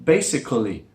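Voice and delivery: "basically" with an audible uh before lee; spelled-out basically. Shown as a demonstration. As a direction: say "basically" as bay-sic-uh-lee. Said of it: "that third syllable".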